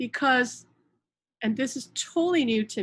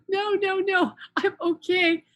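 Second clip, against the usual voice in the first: high, slow retold protest; drawn-out acted "I'm fine"; insistent reassurance; 9 semitones higher, 4 dB louder than usual. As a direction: wave off the worry, pitched well up. Retold protest against friend's alarm.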